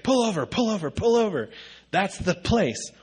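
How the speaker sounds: imitated urgent high-pitched pleading; freaking out. Wife begs husband to pull over, freaking out. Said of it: "she starts freaking out in the car".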